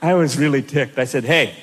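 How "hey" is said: low-pitched, put-on voice; hammed-up low comeback; mock-tough retort. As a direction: answer the instructor back in a low mimicking voice. Ticked reply to French instructor.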